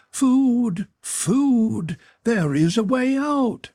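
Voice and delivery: high, wailing plea of a madman; pitiful supplication. A narrator's reading. Maniac on the floor to the Englishman, pleading. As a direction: beg in a thin, high-pitched wail. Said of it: "The pitiful supplication in the tones".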